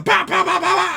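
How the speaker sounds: high loud shot sounds; gunfire noises. Acted. Cowboy shooting falling Martians, shouted gunshot sounds. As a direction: keep firing with your voice.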